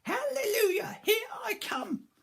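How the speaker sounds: very high cackling crone voice, slowing on here I come; shrieking old grandma. Acted. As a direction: crow it like a giddy old witch. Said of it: "she shouted".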